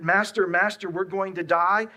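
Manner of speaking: desperate cry. Acted. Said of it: "that cry that the disciples say".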